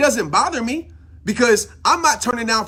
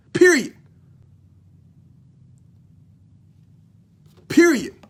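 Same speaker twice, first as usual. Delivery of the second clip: fired-up shout; 5 semitones higher, 4 dB louder than usual. Real, not acted. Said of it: "I'm sorry if I'm screaming, if I'm yelling".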